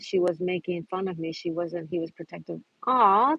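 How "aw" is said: ordinary talk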